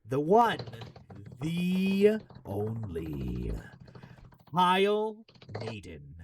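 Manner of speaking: drawn-out, booming announcer introduction; big ring-announcer build-up; announcer intro. Pretend. Hyped introduction of a guest.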